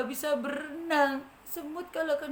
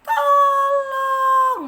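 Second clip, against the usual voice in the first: high, loud drawn-out tolong; high wailing shout; 13 semitones higher, 14 dB louder than usual. Acted. Ant begs the butterfly loudly. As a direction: wail for help. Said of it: "katanya gitu kan".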